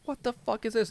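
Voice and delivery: high, incredulous outburst; outraged disbelief; shocked what the. Retold or imitated. Reading his credit report, then shocked disbelief.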